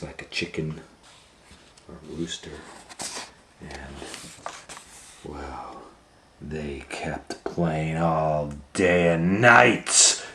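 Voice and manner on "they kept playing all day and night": slow, building to loud; building excitement. A narrator's reading. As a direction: swell up with the wild fun. Building dramatic read.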